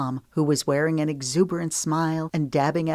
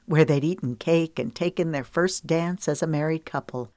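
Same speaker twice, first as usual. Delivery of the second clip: usual speaking voice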